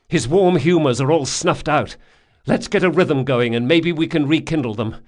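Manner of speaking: loud, high frantic commands; frantic urgent orders. Acted. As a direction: bark orders in a panic. Jean reviving drowned Locke.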